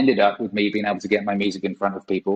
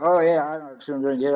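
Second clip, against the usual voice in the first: lightly faked Scottish accent, pitched up; put-on Scottish accent; 4 semitones higher than usual. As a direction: pretend to be a local Scot. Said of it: "this Scottish accent like this".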